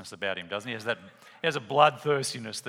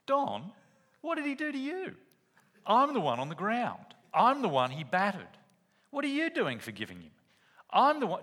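much higher, indignant protest; outraged victim; 8 semitones higher than usual. Retold or imitated. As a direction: protest at the unfairness, pitched way up. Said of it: "Glyn goes".

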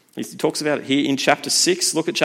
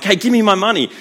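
higher, louder demand for the money; mock demand; 8 semitones higher, 6 dB louder than usual. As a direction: shake down the guy who owes ten bucks. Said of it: "he's like".

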